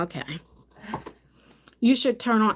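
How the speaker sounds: ordinary talk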